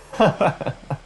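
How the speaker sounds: high, short scornful laugh; mocking laugh. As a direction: laugh at his begging. Jeanne laughs at the begging bishop.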